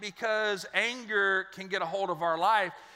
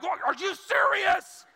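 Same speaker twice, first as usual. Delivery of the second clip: slow, much higher exasperated shout; exasperated outburst; 10 semitones higher, 5 dB louder than usual. Retold or imitated. Pastor cut off mid-prayer shouting at God in disbelief. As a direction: yell it at the heavens in disbelief. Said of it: "I'm like".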